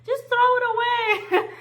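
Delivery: very high, loud disgusted shriek; grossed-out parents yelling at the little girl. Retold or imitated. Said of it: "The parents are, like, gagging".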